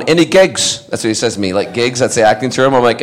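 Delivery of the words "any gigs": lightly voiced eager dad, higher pitched; excitable dad. Dad on the phone to his actor son, eager. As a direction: ask it high, imitating an eager dad.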